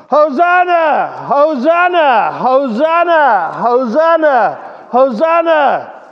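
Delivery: loud, high, slow drawn-out shouts of Hosanna; shouting Hosanna. Real, not acted. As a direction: lead the crowd in shouting it. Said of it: "Really shout it".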